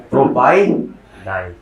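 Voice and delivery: प्रोपाइनाइल very slowly, pausing before Nile; teaching pronunciation. Shown as a demonstration. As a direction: model प्रोपाइनाइल in long separate parts. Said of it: "कैसे बोलो?". (प्रोपाइनाइल को तोड़कर, धीरे-धीरे)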